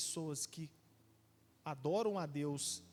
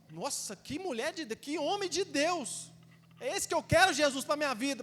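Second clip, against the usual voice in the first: much higher, louder gushing praise; mock admiring churchgoer; 8 semitones higher, 8 dB louder than usual. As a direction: gush over the holy person, hammed-up. Imita fiéis admirados na igreja. (fiel deslumbrado, exagerado e bem mais alto)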